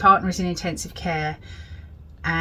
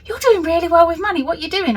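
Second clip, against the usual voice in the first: curious family; 10 semitones higher, 3 dB louder, faster than usual. Retold or imitated. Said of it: "my family was like".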